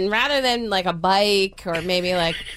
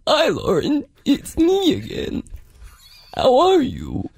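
slow, higher eerie alter-ego voice; Other Mother; 5 semitones higher than usual. Retold or imitated. Mom's alter ego opening a voicemail, creepy put-on voice. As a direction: draw it out as a creepy put-on character. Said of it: "she started every voicemail like this".

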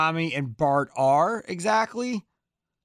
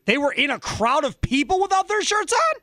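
disbelieving question; 11 semitones higher than usual. Real, not acted. Host shocked at photo news.

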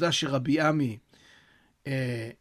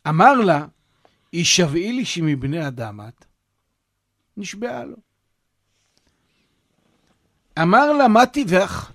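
reading the source text aloud; 5 dB louder than usual. Acted. Reads the source story aloud.

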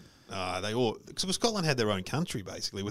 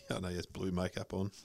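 ordinary talk

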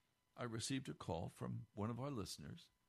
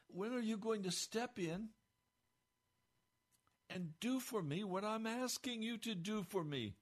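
pleading prayer; 9 semitones higher than usual. Retold or imitated. Quoted pleading prayer to God.

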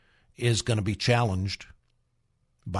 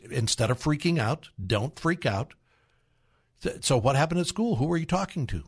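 ordinary delivery

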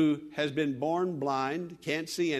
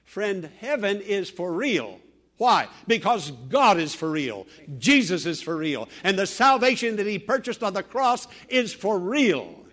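preaching at full force; 3 semitones higher, 7 dB louder than usual. Real, not acted. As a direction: proclaim it to the congregation.

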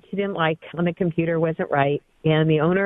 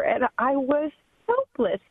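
ordinary talk, nothing marked

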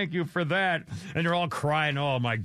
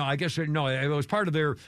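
ordinary talk, nothing marked

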